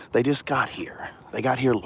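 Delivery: hushed secret whisper. Real, not acted. Whispering about new cars arrived.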